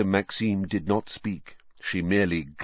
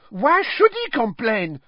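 put-on high, louder French girl's outburst; Fleur bursting out; 5 dB louder than usual. A narrator's reading. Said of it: "burst out Fleur Delacour".

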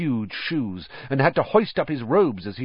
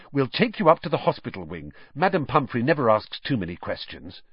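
quick reassurance; 2 semitones higher, faster than usual. Acted. Harry reassuring Hermione quickly.

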